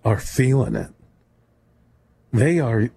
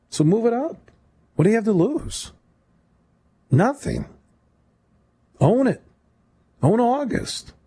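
excited; 9 semitones higher than usual. Real, not acted. Host pushing his pitch.

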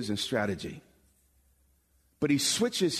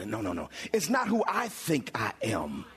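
ordinary delivery

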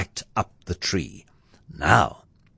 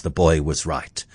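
plain reading voice

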